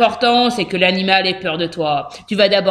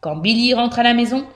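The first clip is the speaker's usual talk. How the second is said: usual speaking voice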